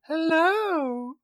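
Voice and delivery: put-on high girly hello; girl voice. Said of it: "my best girl voice".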